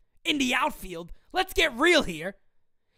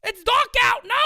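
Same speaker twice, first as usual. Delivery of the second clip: normal talking voice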